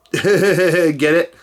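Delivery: ordinary talk, nothing marked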